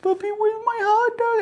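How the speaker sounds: very high, loud wail; hammed-up tearful plea in a squeaky high pitch; mock crying child. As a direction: imitate a kid waking up bawling for food. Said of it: "And then he wakes up again".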